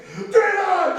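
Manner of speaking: loud, high battle call; yelled call. Real, not acted. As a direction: bellow it out to the crowd. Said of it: "I want you to yell".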